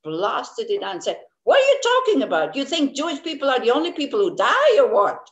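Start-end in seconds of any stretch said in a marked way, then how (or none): 1.4-5.2 loud, high, fast angry blast; retold furious outburst. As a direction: snap at her in full anger. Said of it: "this is how I said it".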